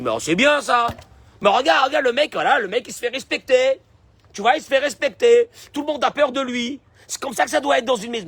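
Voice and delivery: high, loud mock boast; mocking the proud husband. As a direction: ape the man who brags about being feared. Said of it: "Parce que toi, tu dis". (imitation moqueuse du mari fier)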